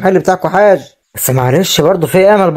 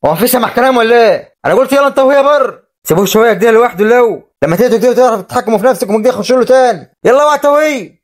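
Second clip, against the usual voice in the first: higher, scolding shout to clear the room; angry orders; 6 semitones higher than usual. Acted. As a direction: drive them out, losing patience. Uncle orders mourners out. (زعيق غاضب لطرد الناس)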